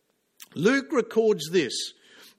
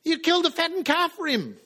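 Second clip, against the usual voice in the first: indignant elder son; 6 semitones higher than usual. Acted. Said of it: "the elder son says".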